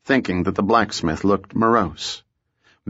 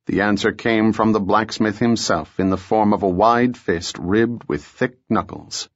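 ordinary delivery, nothing marked

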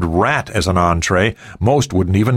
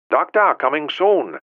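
put-on Cantonese accent, high and light; 8 semitones higher than usual. A narrator's reading. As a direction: answer the phone in a Cantonese accent. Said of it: "a voice with a Cantonese accent".

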